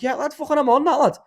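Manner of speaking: high, loud eager blurt; waking up mid-chat, blurting agreement loudly; groggy eager agreement. Retold or imitated. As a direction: jump in keen and loud. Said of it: "be like".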